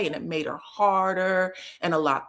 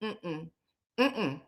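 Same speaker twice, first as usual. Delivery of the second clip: tight closed-mouth mm-mm, holding words back; pursed-lip mock hum; lips-pressed hum; 3 semitones lower, 8 dB quieter than usual. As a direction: hum through pressed lips like fighting not to talk. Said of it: "She was like this".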